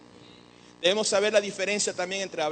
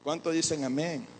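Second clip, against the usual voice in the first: ordinary delivery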